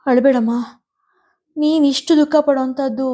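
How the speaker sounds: ಆತಂಕದಿಂದ ಬೇಡುವ ದನಿ; anxious. A narrator's reading. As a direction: beg her mother to tell, worried. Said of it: "ಆತಂಕದಿಂದ".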